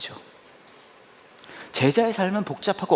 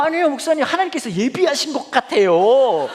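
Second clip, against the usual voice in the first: sweet insisting staffer; 13 semitones higher, 7 dB louder than usual. Retold or imitated. Staffer insisting God prepared this, high sugary voice. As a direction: stretch it out sweetly through a laugh.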